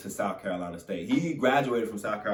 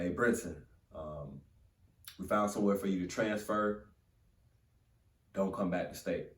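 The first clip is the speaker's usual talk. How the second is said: flat coach news; 4 semitones lower, 8 dB quieter than usual. Retold or imitated. Coach on the phone cutting him.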